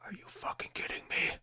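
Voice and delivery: hissed in disbelief; whispered fury. Real, not acted. Vlogger outraged at sister wasting the soda, whispered fury. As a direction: seethe it under the breath.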